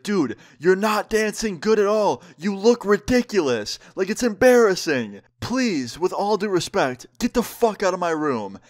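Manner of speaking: high-pitched exasperated mock rant; snapping, imitated. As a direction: act out a son losing it with his mom. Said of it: "Josh just snaps".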